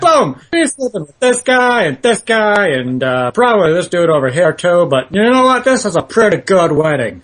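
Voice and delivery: high, rambling sports commentator impression; John Madden. Comedian imitating a commentator officiating a wedding, high sportscaster voice. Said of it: "doing John Madden's wedding".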